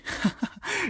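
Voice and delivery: high, nervous giggle; a jittery laugh to dodge the question. A narrator's reading. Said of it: "laughing nervously".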